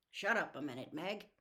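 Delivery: plain reading voice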